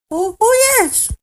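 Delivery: very high tiny squeak; piping voice. A narrator's reading. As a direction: pipe it up like a tiny creature. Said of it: "piped the voice".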